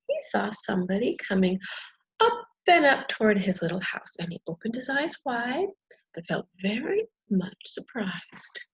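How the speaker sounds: gentle read-aloud storytelling; story narration. A narrator's reading. Reading a fairy tale aloud.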